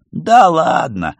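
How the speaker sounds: loud, high, drawn-out brush-off; dismissive disbelief. A narrator's reading. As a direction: wave it away loudly, stretching the words. Kolka dismisses the ghost's claim. (громко и протяжно отмахнуться)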